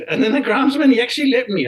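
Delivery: laughing amazement, pitch jumping up; amused disbelief. Real, not acted. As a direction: tell it through an incredulous laugh. Laughs in disbelief at own story.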